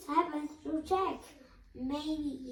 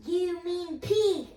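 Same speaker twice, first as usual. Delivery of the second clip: slow, loud, higher furious cry; yelling; 5 semitones higher, 6 dB louder, slower than usual. A narrator's reading. Girl yelling at late Jack, loud and furious. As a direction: hurl it at him, slowly. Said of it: "I yell at him".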